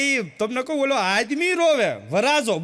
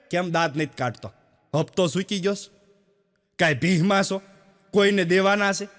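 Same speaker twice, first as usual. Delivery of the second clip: lower, ribbing a sour-faced neighbour; mock taunting; 4 semitones lower than usual. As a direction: poke fun at him, jabbing each question. Teasing a glum neighbour.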